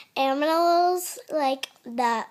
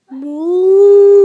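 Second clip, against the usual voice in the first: loud long moo; cow moo; 14 dB louder than usual. Shown as a demonstration. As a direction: bellow like a cow. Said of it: "moving on to cow".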